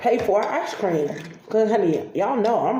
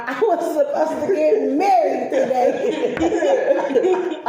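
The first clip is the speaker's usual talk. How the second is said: slow, high, whiny imitation of her sad son; laughing as she imitates her son; mock sad child; 6 semitones higher, much slower than usual. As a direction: drag it out like a heartbroken little boy. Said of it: "he was so sad".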